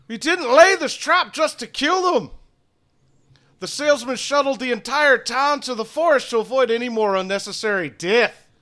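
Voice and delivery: loud, high commanding voice; stern townsman. Acted. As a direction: bark it like an angry elder. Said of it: "the man next to him says".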